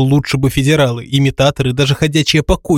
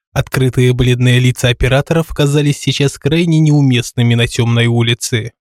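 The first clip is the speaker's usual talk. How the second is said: ordinary delivery, nothing marked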